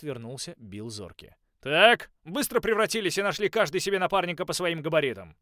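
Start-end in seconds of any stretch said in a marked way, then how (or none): 1.6-5.4 loud, high coach's shout. A narrator's reading. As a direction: bark the orders across the field. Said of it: "крикнул он".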